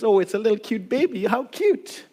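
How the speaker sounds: mock baby-cooing, quick, rising on How cute; a hint of gushing baby talk. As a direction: coo over a cute baby, half-mocking. People cooing over baby Jesus.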